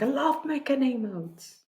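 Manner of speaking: high-pitched, aborted attempt at an Australian accent; half-stifled Australian accent. Quoting the person who made the emotes, half-stifled Australian accent. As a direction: slip into a weird Australian accent, then hold back. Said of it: "stopped myself from doing an Australian accent".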